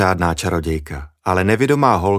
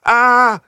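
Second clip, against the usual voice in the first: high, loud short shriek of fright; startled cry; 6 dB louder than usual. Acted. Julča shrieks in the grass at a mouse, startled cry. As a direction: yelp as if a mouse ran past. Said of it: "vykřikla najednou Julča".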